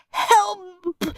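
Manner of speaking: high choked cry; squeezed boy crying out; spluttering cry for help. A narrator's reading. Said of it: "spluttered Peter".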